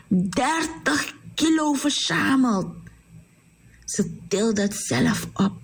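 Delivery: plain reading voice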